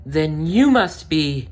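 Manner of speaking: almost burst with awe; excited Cinderella. Acted. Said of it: "gasped Cinderella".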